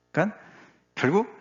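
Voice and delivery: ordinary talk, nothing marked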